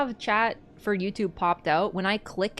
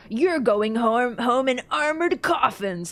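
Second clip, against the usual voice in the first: loud, slow, hammed-up threat; mocked game villain line; 3 semitones higher, 6 dB louder, slower than usual. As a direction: drag out the threat with mock menace. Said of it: "when that guy goes".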